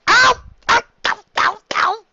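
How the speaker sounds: sharp, very high, loud ow cries; pained yelps. Real, not acted. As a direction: yelp at every blow. Getting hit by Trixie.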